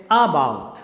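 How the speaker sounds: about mis-stressed on the first syllable, A-bout; wrong stress. Shown as a demonstration. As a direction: hit the A of about and let bout drop. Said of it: "You can't say".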